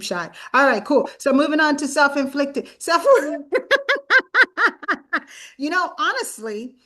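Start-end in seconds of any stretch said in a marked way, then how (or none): 3.1-5.2 a high, loud burst of laughter; real laugh that cracks up the question. Real, not acted.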